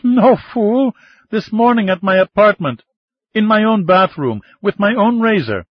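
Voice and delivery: high, loud, laughing retort; laughing woman voice. A narrator's reading. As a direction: throw it back with a big laugh. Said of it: "A riotous laugh now".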